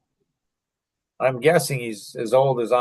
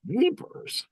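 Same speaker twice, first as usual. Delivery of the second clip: "jeepers" squeaked out much higher and softer; a high, breathy gasp of shock on "jeepers"; stunned jeepers; 12 semitones higher, 6 dB quieter than usual. Real, not acted. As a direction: let "jeepers" escape in disbelief. Stunned reaction to the story.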